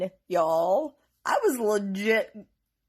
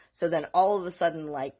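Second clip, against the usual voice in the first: ordinary delivery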